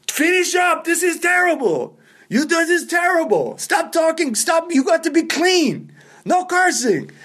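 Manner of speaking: very high, strained heckling yell; Pete shouting. Retold or imitated. Club owner yelling at amateur comics from the doorway, imitated shouting. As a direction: holler orders across the room as an irate coach. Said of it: "He's just shouting at people".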